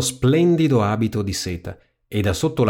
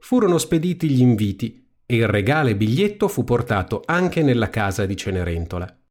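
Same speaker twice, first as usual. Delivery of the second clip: ordinary delivery, nothing marked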